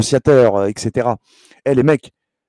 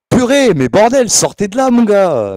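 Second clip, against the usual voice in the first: exasperated outburst; 9 semitones higher, 5 dB louder than usual. Real, not acted. Snapping at critics online.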